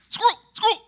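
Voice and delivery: high, loud excited yelps; sudden high-pitched dog outburst; cartoon dog. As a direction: blurt it like a dog spotting prey. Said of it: "he's like the dog in".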